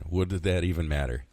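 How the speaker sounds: usual speaking voice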